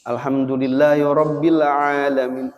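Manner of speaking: ordinary talk, nothing marked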